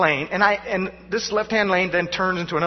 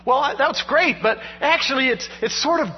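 ordinary delivery

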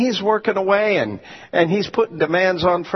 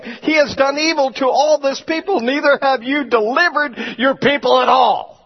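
scripture preaching; 6 semitones higher than usual. Acted. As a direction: declaim Moses' complaint from the pulpit. Preaches scripture at full force.

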